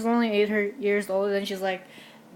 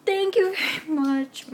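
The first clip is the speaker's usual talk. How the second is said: grateful thanks; 7 semitones higher than usual. Retold or imitated. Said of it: "I'm like".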